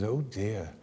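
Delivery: soft counsellor's murmur; reply gently and quietly, as a trained listener; hushed, careful concern; muted sympathy. Retold or imitated.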